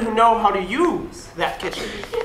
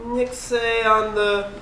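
very slow joking aside; slow deliberate; much slower than usual. Acted. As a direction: drag it out for the joke. Slow Pig Latin aside.

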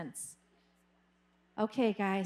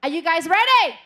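louder rousing host shout; calling to the crowd; 4 semitones higher, 11 dB louder than usual. Real, not acted. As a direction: fire up the audience. Host calls out to audience. (hô hào khán giả)

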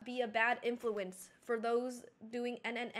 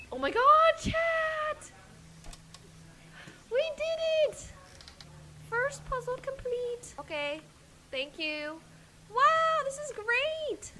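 high, thrilled cheering; excited; 12 semitones higher than usual. Real, not acted. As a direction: celebrate the win, squealing. Solving the first puzzle.

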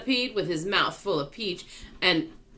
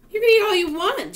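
excited; 14 semitones higher, 7 dB louder, faster than usual. A narrator's reading. James answers the hungry bugs.

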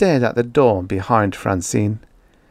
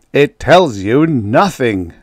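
slow, loud emphatic interruption; forceful, dismissive, weighting each word; barked; 4 semitones higher, 7 dB louder, slower than usual. A narrator's reading. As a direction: cut in with a gruff bark. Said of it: "barked Speidel".

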